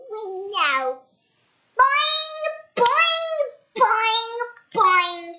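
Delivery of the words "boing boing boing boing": high, loud bouncy boings; spring each boing up high; high loud cartoon boings. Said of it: "said Mister Bounce".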